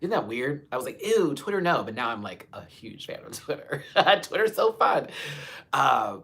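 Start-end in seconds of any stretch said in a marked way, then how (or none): 1.1-1.8 a quick, higher squeal of disgust; mock disgust. Recalling her old view of Twitter, mock disgusted. 3.4-5.1 bright laughing gush, higher and louder. Real, not acted. Gushing to viewers about Twitter, laughing.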